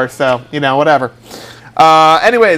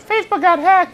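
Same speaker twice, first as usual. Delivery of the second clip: mock headline; 13 semitones higher than usual.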